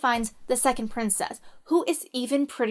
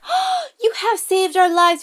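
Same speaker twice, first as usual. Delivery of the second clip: higher, louder swooning thanks; gushing princesses; 7 semitones higher, 4 dB louder than usual. Acted. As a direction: gush like rescued sisters. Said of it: "they're like".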